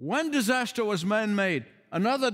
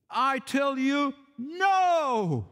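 "no," raised higher and held; emphatic no; 5 semitones higher, much slower than usual. Shown as a demonstration. Preacher quoting Jesus, stressing the word no. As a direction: give "no," a strong lifted push. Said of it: "The word "no" here is in the emphatic position".

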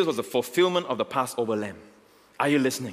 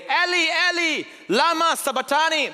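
high, loud anguished cry from the cross; 3 dB louder than usual. Acted. Jesus on the cross crying to God, high loud cry. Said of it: "cried out with a loud voice".

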